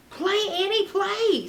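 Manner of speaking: high squeaky plea; squeaking. Acted. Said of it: "squeaked Jack".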